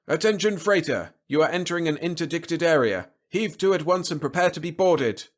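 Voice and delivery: high, loud official warning; patrol radio voice. A narrator's reading. Patrol boat warning a freighter, high commanding voice. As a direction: order the ship to stop over the radio. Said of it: "a voice from the patrol boat".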